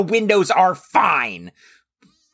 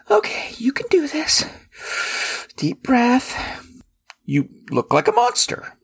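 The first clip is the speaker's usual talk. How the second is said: whispering; 5 semitones higher than usual. Acted. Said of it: "unvoiced whisper".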